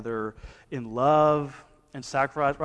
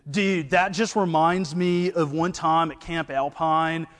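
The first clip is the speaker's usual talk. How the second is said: higher surfer dude twang; Brewton surfer-Southern accent; 4 semitones higher, 4 dB louder than usual. Retold or imitated. Connor at Bible study, a surfer-dude Southern accent. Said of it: "he goes".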